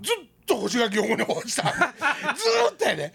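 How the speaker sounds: laughing, drawing out the word. Real, not acted. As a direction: chuckle through it, amused. Laughing at his own mishap.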